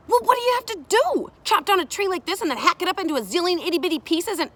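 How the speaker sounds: high, loud, rapid questioning; excited child. Acted. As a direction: rattle it off like an eager kid.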